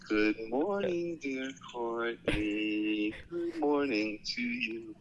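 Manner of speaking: ordinary talk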